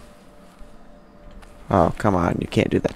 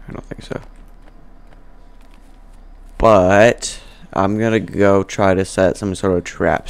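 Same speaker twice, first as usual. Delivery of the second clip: ordinary talk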